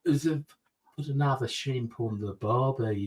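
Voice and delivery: mock Australian lilt, down and then up; demonstrated Australian twang; Australian accent. Shown as a demonstration. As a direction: put on an Aussie accent. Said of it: "Australian or Austrian is like".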